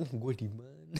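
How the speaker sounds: soft, dazed mock murmur; waking from faint. Actor retelling waking after fainting, soft and disoriented. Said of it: "gua bangun mata gua buka nih".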